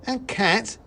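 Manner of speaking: high, loud squeak of surprise; a small soldier suddenly alarmed; startled mouse. Acted. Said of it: "said the first".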